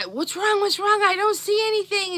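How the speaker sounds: high, urgent questions; a frightened father searching for an injury; worried dad. Retold or imitated. Dad checks his daughter over for wounds, alarmed.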